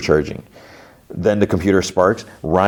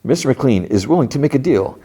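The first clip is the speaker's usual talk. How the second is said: higher, oily salesman patter; snake oil salesman; 3 semitones higher than usual. Retold or imitated. Salesman delivering McLean's message, higher salesman voice. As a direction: pitch it like a sly salesman. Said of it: "And the snake oil dude, he's like".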